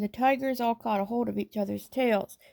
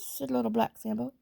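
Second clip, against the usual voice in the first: ordinary delivery, nothing marked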